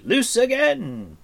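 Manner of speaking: high, loud, stretched command; cried out. Acted. Bard orders another volley, crying out. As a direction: call the volley out long and high. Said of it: "Fluter cried".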